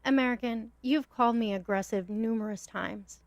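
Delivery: ordinary delivery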